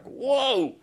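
high startled whoa; mock gasp. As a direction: pull back like you've seen something awful. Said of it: "people are like".

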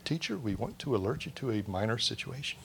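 softly and politely, like a minor matter; a deadpan alert about a sinking boat; mock calm. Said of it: "calm, relaxed".

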